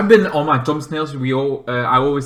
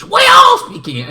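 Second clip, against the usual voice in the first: much higher, louder and faster, "all" shouted; shouted repeat; 8 dB louder, faster than usual. Retold or imitated. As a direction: blast the repeat like a cheer. Family drilling speaking English.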